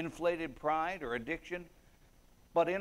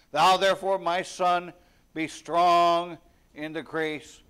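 preaching exhortation; 3 semitones higher, 9 dB louder than usual. Acted. Paul exhorts Timothy, preaching.

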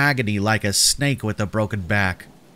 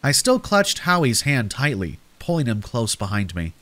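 plain reading voice

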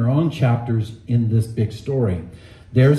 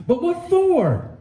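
put-on high, slow child's plea; pleading boy; 14 semitones higher, slower than usual. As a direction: ask it like a bewildered boy. Shasta asking Aslan.

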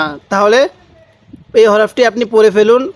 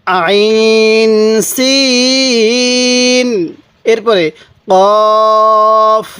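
আইন সিন held very long, কফ short and firm; elongated recitation; much slower than usual. Shown as a demonstration. Teacher reciting for viewers, each letter very slowly drawn out. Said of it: "সিনের মধ্যে চার আলিফ টান দিবেন".

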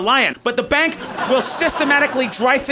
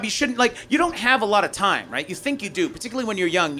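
ordinary talk

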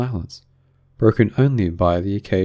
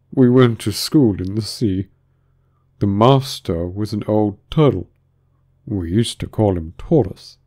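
slow, lightly mournful turtle voice; calmer but sorrowful; 4 dB louder than usual. A narrator's reading. Mock Turtle recalling his schooldays, slow, a little tearful. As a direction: drag the words out with a touch of sorrow. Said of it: "though still sobbing a little now and then".

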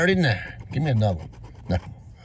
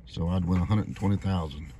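ordinary delivery

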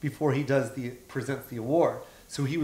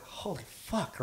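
soft, slightly raised stunned aside; hushed awe; 4 semitones higher, 11 dB quieter, slower than usual. Retold or imitated. Host realizes second star wants stage, hushed awe. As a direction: breathe it out quietly, stunned. Awed seeing Robin waiting to go on.

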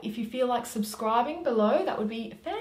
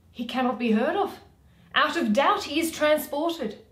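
lightly voiced Starveling, louder and quick; 6 semitones higher, 8 dB louder than usual. Starveling telling the players Bottom is lost, a touch louder. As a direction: rush out the bad news a little louder.

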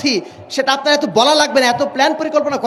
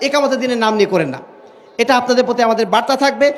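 normal talking voice

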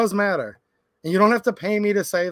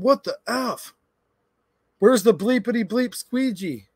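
retold angry yell, higher; angry shout; 5 semitones higher, slower than usual. Stranger at gas pump yelling about the squeegee, angry shout. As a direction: bark it like a furious stranger. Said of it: "I hear super loud".

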